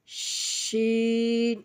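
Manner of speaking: শিন with long, thick sh and drawn-out vowel; thick airy shin. Shown as a demonstration. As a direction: push a thick stream of air into শিন. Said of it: "একটু মোটা করে বাতাস বের হবে". (মোটা করে বাতাস ছেড়ে শিন)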